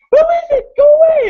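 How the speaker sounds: very high, loud damsel squeal; mock frightened woman. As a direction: imitate her alarmed role-play shriek. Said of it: "she plays the part perfectly".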